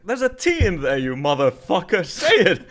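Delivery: loud, high exasperated rant; loud mock rant. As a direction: shout it half laughing.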